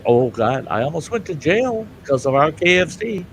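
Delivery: ordinary talk, nothing marked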